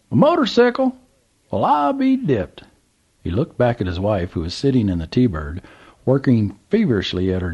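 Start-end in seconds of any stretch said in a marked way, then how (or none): 0.1-2.6 put-on old Southern gentleman. A narrator's reading. Old man calls to trucker, high Southern voice. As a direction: play the curious old man at the gas pump.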